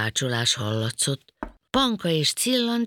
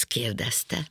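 plain reading voice

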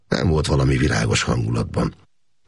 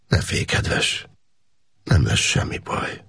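tender, soothing; slower than usual. A narrator's reading. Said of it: "kedves, becéző hang volt".

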